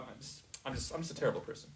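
a resigned murmur under the breath; muttered to oneself. Shown as a demonstration. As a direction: mutter it like a quick private verdict. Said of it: "You see how you skip the whole thing?".

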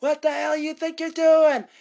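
high, loud yell; mock yell. Ben yelling at Tommy from a distance, loud mock yell. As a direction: call out across the distance, hammed-up. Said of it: "Ben sees it from a distance and says".

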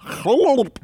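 high laughing burst; burst of laughter. Real, not acted. Laughing at VidCon memory.

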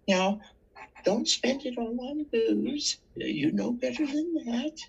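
put-on high little-old-lady voice; sweet old lady. Two little ladies warning the panhandler, high and prim. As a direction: play a sweet old lady gently scolding. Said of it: "They put a whole fucking dollar in my box and said".

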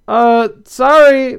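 high, drawn-out nervous apology. A narrator's reading. Skunk apologizes for stinking up the room, still nervous. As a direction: apologize loudly and awkwardly, stretching it out. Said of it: "still nervous".

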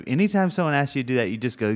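ordinary talk

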